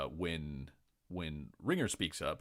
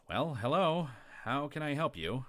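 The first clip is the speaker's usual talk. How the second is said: higher, louder fake-polite clerk greeting; gnome clerk; 8 semitones higher, 4 dB louder than usual. Gamemaster voices gnome clerk greeting late visitors, higher and louder. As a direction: greet them with forced cheer.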